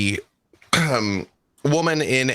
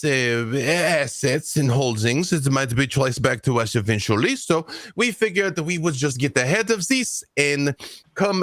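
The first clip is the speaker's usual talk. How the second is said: French negotiator; 2 semitones higher than usual. Acted.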